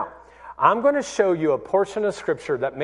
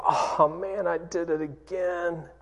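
guilty self-reproach; 6 dB quieter than usual. Retold or imitated. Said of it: "had a godly guilt".

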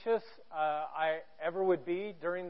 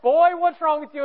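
loud, raised, scolding uncle voice; 9 semitones higher, 9 dB louder than usual. Retold or imitated. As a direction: holler it like a baffled tough guy. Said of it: "who was a big redneck, tough guy".

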